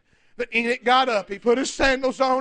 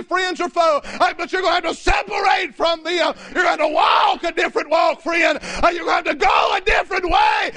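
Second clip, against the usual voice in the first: full-force preaching; 5 semitones higher than usual. Real, not acted. Preacher denouncing evil to his congregation, full-force preaching. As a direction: drive every phrase home to the congregation.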